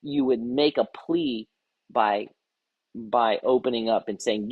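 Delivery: ordinary talk, nothing marked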